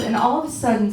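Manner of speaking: ordinary delivery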